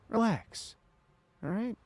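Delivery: high, drawn-out relax; teasing relax. Real, not acted. Streamer telling impatient chat to calm down, high teasing. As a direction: soothe the chat playfully.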